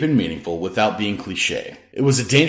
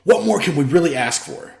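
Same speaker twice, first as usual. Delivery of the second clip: mild exasperation; 8 semitones higher than usual. A narrator's reading. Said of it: "Antics blurted out in exasperation".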